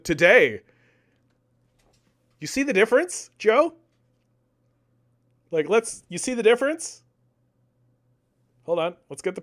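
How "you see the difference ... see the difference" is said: much higher, sarcastic challenge; sneering high-pitched you see the difference; mocking question. Real, not acted. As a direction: taunt him with the question. Mocking rhetorical question to Joe.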